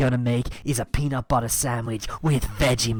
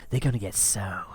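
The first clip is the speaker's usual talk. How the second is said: whisper. Acted. Parody ASMR cooking stream.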